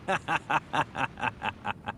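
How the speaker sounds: long staccato chuckle, fairly soft; derisive laugh. Acted. Boss who always thought she was a bitch, derisive laugh. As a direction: chuckle on and on at the insult. Laugh after insult about woman.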